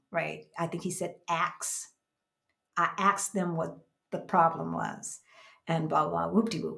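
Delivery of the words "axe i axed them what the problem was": "asked" pronounced like "axed"; ask said as axe. Retold or imitated. Quoting the attorney's way of talking, "asked" said as "axed". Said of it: "saying some things about the way he was talking".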